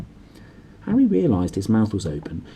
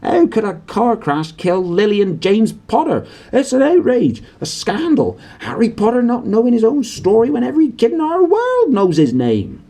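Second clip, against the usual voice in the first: much higher, louder indignant shout; furious outrage; 13 semitones higher, 6 dB louder than usual. A narrator's reading. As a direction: rage at the injustice. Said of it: "jumping up so angrily".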